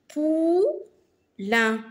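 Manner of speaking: "Poulain" split into pou, lain; syllable by syllable. Shown as a demonstration. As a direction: hold "pou", pause, then "lain" in "Poulain". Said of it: "dans la deuxième syllabe". (« Poulain » découpé en deux syllabes détachées)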